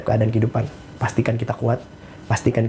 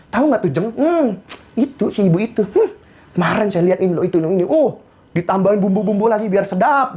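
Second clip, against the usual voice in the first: high, hammed-up gossip with knowing hums; gossiping housewife; 8 semitones higher than usual. Housewife telling friends about another woman at arisan, gossipy. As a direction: dish the dirt in a high mock-housewife voice. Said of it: "ibu-ibu coba kalau lagi ngumpul arisan".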